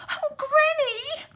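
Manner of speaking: very high, crying out slowly; a little girl on the edge of tears; frightened girl. Acted. Girl scared of granny's face, crying out high.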